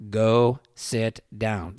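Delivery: each word drawn out and separate; slow it right down, firm; slow, deliberate order. Retold or imitated. Dad calmly ordering an angry cop, slow and deliberate. Same order repeated, slowed.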